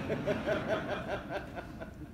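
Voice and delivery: a quiet breathy chuckle; soft laugh. Real, not acted. Speaker runs out of time, soft self-mocking laugh. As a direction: laugh it off softly at yourself.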